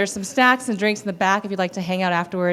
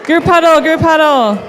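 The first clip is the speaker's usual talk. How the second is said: calling out; 6 semitones higher, 7 dB louder than usual. Real, not acted. Calling everyone together.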